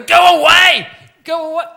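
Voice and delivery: shouted high and loud; a teacher yelling at a pestering kid; teacher shouting. Retold or imitated. PE teacher yelling at a pupil at his door, loud shout. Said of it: "And he'd go".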